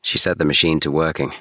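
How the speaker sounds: plain reading voice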